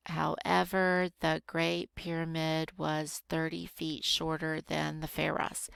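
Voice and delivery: measured, even teacher's rereading. Shown as a demonstration. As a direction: reread it carefully, word by word. Rereads sentence slowly to find prepositions.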